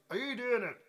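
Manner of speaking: high-pitched, loud nosy question; intruder's voice. Retold or imitated. Somebody at the toilet door asks, in a high intruder's voice. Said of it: "somebody goes".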